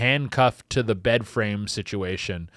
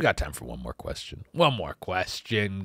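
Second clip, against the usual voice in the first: much lower, softer, slowed announcement; mock deep voice; 7 semitones lower, 9 dB quieter than usual. Shown as a demonstration. Comic announcing the last question to the crowd, deep voice. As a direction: drop into a deep showman voice. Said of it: "mock deep announcement".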